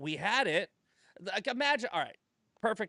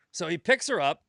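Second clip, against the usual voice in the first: ordinary talk, nothing marked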